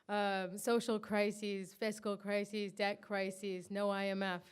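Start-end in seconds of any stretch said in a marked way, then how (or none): none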